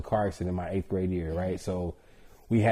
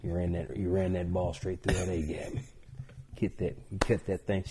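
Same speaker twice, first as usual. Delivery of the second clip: imitated East Tennessee local, lower and softer; Morristown Tennessee accent; 3 semitones lower, 7 dB quieter than usual. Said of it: "where everybody talks like this".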